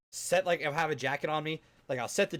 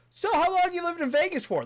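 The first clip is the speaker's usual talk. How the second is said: chatty driver impression; 5 dB louder than usual. Mocking chatty Uber driver.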